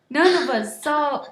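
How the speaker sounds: plain reading voice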